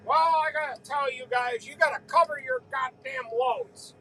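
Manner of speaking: a retold angry grumble, only lightly voiced; irate neighbor. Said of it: "he was irate".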